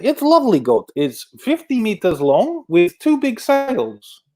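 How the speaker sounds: high-pitched, slow mock Russian accent; bad Russian accent. Athlete describing her lovely goat, bad Russian accent. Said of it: "not quite this bad Russian accent".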